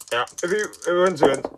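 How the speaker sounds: quick put-on pundit voice; second commentator voice. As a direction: try out a different co-commentator voice. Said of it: "That's better".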